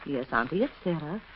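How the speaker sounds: soft, slow, syrupy sweetness; honeyed tender. Acted. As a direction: coo at the sick aunt. Niece sweet-talks dying aunt.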